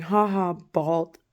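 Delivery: taunting jeer rising high at the end; mocking mimicked yell; mock screaming. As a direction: imitate a screamed taunt, not full force. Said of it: "and screaming".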